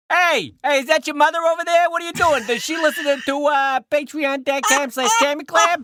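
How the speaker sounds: high, loud Boston caricature; Boston accent. Shown as a demonstration. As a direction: call out in a hammed-up Boston accent.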